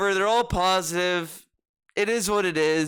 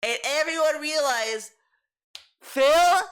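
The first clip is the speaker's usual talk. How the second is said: hyped DSB podcast rant; 5 semitones higher than usual. Retold or imitated. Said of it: "this will go on his little fucking dumbass podcast".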